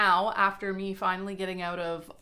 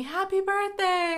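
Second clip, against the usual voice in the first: mock birthday greeting; 12 semitones higher, 5 dB louder, slower than usual. Mimicking people's birthday wishes.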